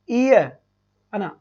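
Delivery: ई ए stretched very slowly; slow drawn-out vowels. Shown as a demonstration. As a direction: say ई then ए slowly for the class. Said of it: "क्या लिखा बेटा". (ई ए को धीरे-धीरे खींचकर)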